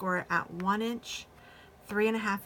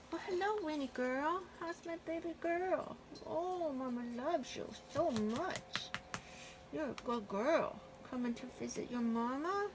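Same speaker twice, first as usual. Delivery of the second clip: sweet nothings; 8 semitones higher, 5 dB quieter than usual. Real, not acted. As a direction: coo lovingly at your dog, way up high. Owner coos to her dog.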